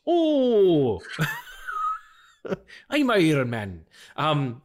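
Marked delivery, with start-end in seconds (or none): 2.9-3.9 mock Irish accent. Shown as a demonstration. Joking Iron Man is Irish, mock Celtic accent. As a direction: ham up a Celtic accent, laughing through it. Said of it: "That was Scottish".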